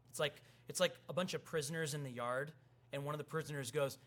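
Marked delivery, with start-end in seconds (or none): none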